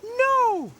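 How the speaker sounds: very high, loud cartoon voice; squeaky storybook character; Tech. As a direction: refuse in a tiny piping voice. Narrator voices Tech answering.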